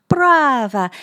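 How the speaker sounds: loud, high Italian bravo; exclaimed praise. A narrator's reading. Lisa quoting his praise, exclaimed loudly. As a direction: call it out with a flourish.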